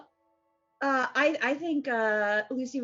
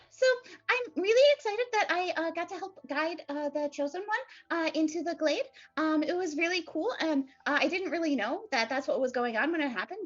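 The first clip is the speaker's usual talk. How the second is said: prattling pixie; 11 dB louder than usual. Acted. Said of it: "she starts kind of prattling on".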